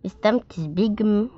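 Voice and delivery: ordinary delivery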